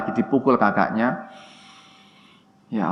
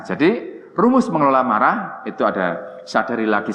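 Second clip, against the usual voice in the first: ordinary delivery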